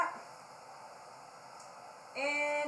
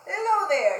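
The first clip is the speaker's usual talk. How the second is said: loud, high proud greeting from Pig; Pig's cheerful hello; 6 semitones higher, 11 dB louder than usual. Acted. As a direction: call out hello like a show-off pig. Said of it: "said Pig to Monkey".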